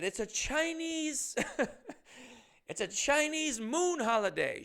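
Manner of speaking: high-pitched mock Chinese accent; lightly hammed-up Chinese accent, high. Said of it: "does have a thick Chinese accent".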